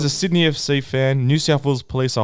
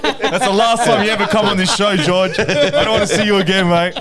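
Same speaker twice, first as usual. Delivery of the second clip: raised, mock-outraged rebuke; mock angry telling-off; 4 semitones higher, 4 dB louder than usual. Podcast host mock-banning an absent regular, playfully outraged. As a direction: pretend to ban him from the show, loudly.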